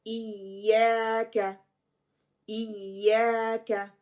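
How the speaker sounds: इय्याका slowly, first य doubled with stress, या held long; careful slow इय्याका, stress on the doubled य; slow correct recitation. Shown as a demonstration. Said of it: "पहली जो साकिना है उस पे नबर होगी".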